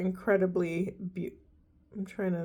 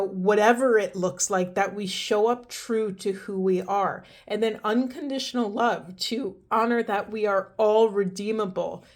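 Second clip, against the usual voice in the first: normal talking voice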